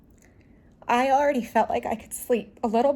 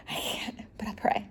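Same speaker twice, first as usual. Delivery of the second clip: faint whisper; 10 dB quieter than usual. Retold or imitated. Weak reply to the doctor.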